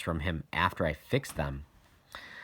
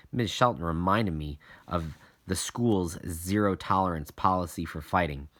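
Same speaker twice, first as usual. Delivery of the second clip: plain reading voice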